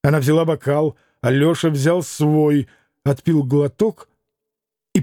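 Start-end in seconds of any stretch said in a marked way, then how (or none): none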